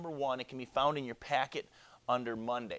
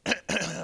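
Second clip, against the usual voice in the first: loud staged ahem; pompous herald's ahem; official throat-clearing; 5 semitones higher, 5 dB louder than usual. Acted. Messenger readying an official announcement.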